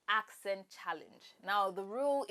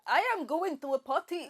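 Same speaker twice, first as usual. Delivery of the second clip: raised, bouncy Indian accent; 4 semitones higher, 4 dB louder than usual. Shown as a demonstration. Host answering the challenge, Indian accent. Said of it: "in India".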